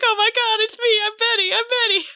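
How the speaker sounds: lightly hammed-up desperation; frantic. Betty begging her friends to recognise her, high and excited.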